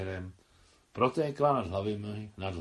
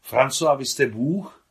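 loud, raised cry of amazement, drawn out; shouted question; 5 semitones higher, 9 dB louder, slower than usual. A narrator's reading. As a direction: call it out high and slowly. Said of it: "křikla do dlaní Mášenka". (hlasitě a užasle zvolaná otázka)

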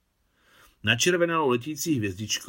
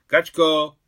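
loud, higher call into the flat; shout across the flat; 6 semitones higher, 11 dB louder than usual. A narrator's reading. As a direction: call her far off in the other rooms. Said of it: "vykřikla hned do hlubiny bytu".

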